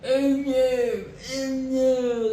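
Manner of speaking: hammed-up dismay from the royals; mock royal fret.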